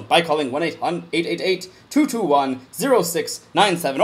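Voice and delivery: usual speaking voice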